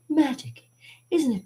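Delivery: high, wonder-filled magician impression; Doug Henning. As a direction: marvel like a stage magician, starting high. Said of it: "him doing like this, be like".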